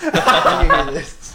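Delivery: breathy laugh-through, loud. Real, not acted. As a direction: wheeze it while laughing. Laughing message to Charlie.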